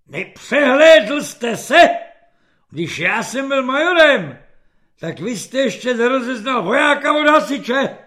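loud, high sarcastic dressing-down; angry rebuke. A narrator's reading. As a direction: tear into the man for getting your rank wrong. General rebukes the wrongly addressed rank. (rozhořčené hlasité kárání)

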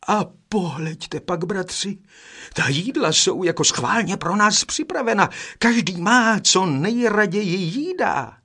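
second brother exclaiming with joy; excited exclamation. A narrator's reading. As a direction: call the brothers over in wonder. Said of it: "zvolal druhý".

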